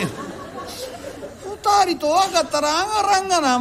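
slow, high laughing complaint; amused bearer laughing as he speaks. Acted. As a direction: chuckle it out, drawn out and high. Bearer laughing at the customers' trick.

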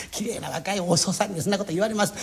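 ordinary delivery

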